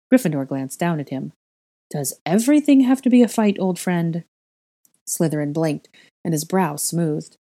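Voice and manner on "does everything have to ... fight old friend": raised, louder exasperated question; exasperated appeal. A narrator's reading. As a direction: protest wearily at the endless quarrel, voice lifted. Gryffindor snaps back in a quarrel.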